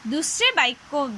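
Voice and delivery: normal talking voice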